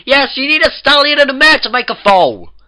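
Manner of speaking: very high, full-force shout all through; exasperated yell. A narrator's reading. Twilight loses patience with the Cakes, exasperated yell. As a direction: yell the obvious at the top of your voice.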